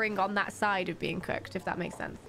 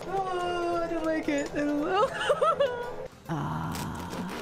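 ordinary talk

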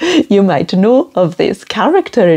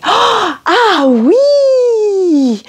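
"Ah, oui" with a gasp, high and keen; eager French yes; 13 semitones higher than usual. Shown as a demonstration. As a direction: gasp then a delighted ah oui. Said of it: "If you wanna say yes, say".

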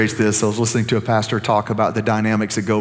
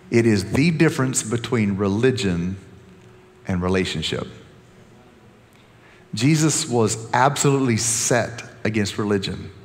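ordinary talk, nothing marked

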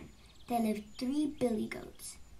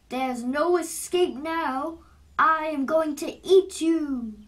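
loud, slow, booming threat; menacing troll; 3 semitones higher, 10 dB louder than usual. A narrator's reading. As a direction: roar it as the troll closing in. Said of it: "said the troll".

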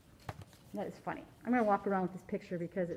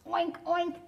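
higher piglet oinks; little pig squeal; 7 semitones higher than usual. Acted. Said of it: "the piglet squealed".

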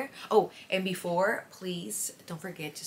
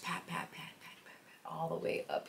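soft whispered pats; whispering; 9 dB quieter than usual. Shown as a demonstration. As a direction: breathe it out with each pat. Demonstrating patting on eye cream.

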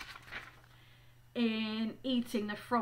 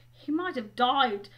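rising, louder note of scorn; disgusted; 5 semitones higher, 5 dB louder than usual. Acted. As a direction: grumble in exasperated disgust. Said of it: "said Ron in disgust".

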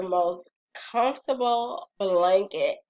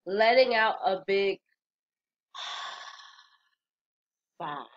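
big release sigh. Shown as a demonstration. Host demonstrating a releasing sigh to viewers. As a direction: let the breath all the way out. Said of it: "what I just did was just so powerful".

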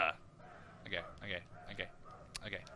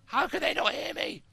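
mock bewildered hero; 14 semitones higher, 3 dB louder than usual. Mock-puzzled at guards.

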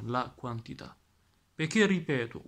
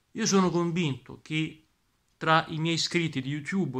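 normal talking voice

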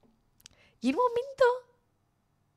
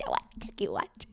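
mock low voice; 11 dB quieter, slower than usual. Mocking low voice.

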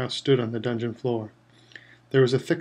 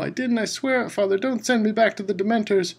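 much higher, pleading terror; frantic plea; 8 semitones higher than usual. A narrator's reading. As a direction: beg not to be sent back. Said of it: "shrieked the boy".

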